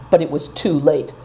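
ordinary delivery, nothing marked

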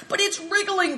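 high-pitched cry of alarm. Acted.

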